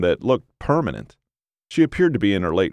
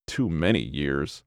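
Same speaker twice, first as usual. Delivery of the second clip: low, slow, softer gruff reply; gravelly old husband; 4 semitones lower, 6 dB quieter than usual. A narrator's reading. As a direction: grumble it back slowly, pitched down. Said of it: "the gravelly voice replied".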